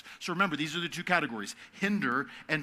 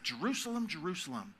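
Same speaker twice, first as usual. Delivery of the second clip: compassionate lament; 6 dB quieter than usual. Shown as a demonstration. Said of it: "act of compassion".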